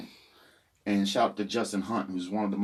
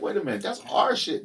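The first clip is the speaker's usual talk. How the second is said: imagined indignant protest; 9 semitones higher than usual. Retold or imitated. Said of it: "you'd be like".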